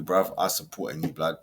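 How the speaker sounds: ordinary talk, nothing marked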